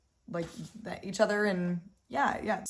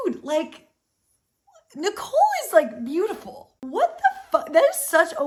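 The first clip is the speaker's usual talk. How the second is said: high, loud, incredulous outburst; outraged disbelief; 9 semitones higher, 8 dB louder than usual. Real, not acted. Reacting to Sam's comment on his wife, high and loud outrage. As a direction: shriek it in disbelief.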